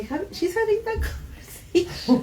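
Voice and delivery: high laughing talk. Real, not acted. Guest laughing about the elder's chat, real laugh. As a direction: laugh through the words.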